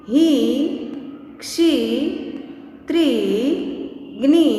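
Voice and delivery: each syllable from की to ग्नी with a long, held ee; long vowel. Shown as a demonstration. Teacher reciting की, खी, गी for the children, long vowel. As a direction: stretch the ee in की, खी, गी and on, pausing between. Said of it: "इसका उच्चारण लंबा हो जाता है".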